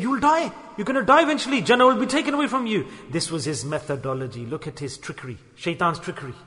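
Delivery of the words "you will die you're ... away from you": high, insistent doom-saying; alarming warning. Acted. Shaitan frightens Adam and Hawa, in a high urgent warning. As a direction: press the warning home in a raised pitch.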